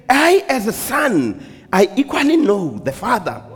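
high preacher voice with long-held words; impassioned preaching. Acted. Preacher voicing the shepherd's words to congregation, impassioned preaching. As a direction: proclaim it, stretching "know" and "mine".

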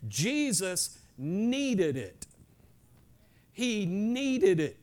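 slow, with "needed" stretched out; stress on needed. Real, not acted. Preacher driving home Jesus's need, "needed" stretched. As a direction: hammer "needed" slowly, stretching it. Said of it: "I emphasize this word".

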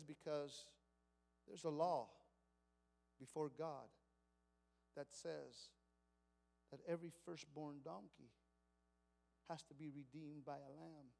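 father telling his family they must lose the donkey; grieving father. Acted. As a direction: break the news you dread. Father breaks the bad news.

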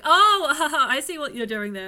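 laughing "oh, I see"; amused, knowing and loud; delighted realization. Retold or imitated. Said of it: "you'll go, like".

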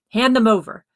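loud, sharp demand. A narrator's reading. Father demands the socks from his son, loudly. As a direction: order it, full voice. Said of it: "demanded his father".

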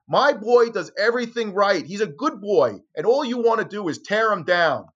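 high, loud imitating a distraught mother. Mother to the reporter on her porch, high frantic imitation. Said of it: "She, she actually says".

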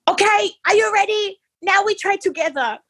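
high, bright coaxing voice; cheery nurse. Retold or imitated. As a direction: coax the patient along. Nurse voiced high, far timbre.

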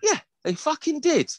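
high, whining indignant reply; aggrieved confirmation. Retold or imitated. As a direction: tell on the kids in high indignation. Aggrieved answer to the neighbour.

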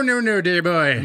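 hammed-up English gent; English accent. Showing cohost how they say no, hammed-up English accent. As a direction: stretch it in a mock English accent. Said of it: "It's like this".

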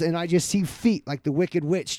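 ordinary talk

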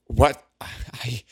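jolted into disbelief; shocked what. Acted. Alondra shocked.

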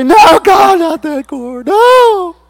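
high, loud cry of protest; panicked protesting shout. Acted. Believer begging God to leave that cord alone, panicked shout. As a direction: yell it out, stretching the no.

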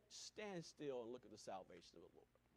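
low, soft calm assurance; calm command. Retold or imitated. Moses calmly telling the people to stand still. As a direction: say it quietly, steadily. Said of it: "He just said".

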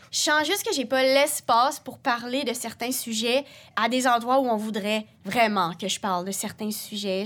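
normal talking voice

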